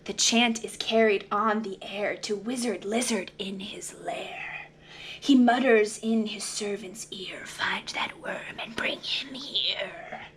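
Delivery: whispered, hushed storytelling; spooky whisper. Acted. Reader whispering the wizard's lair to children. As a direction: whisper it like a secret spell.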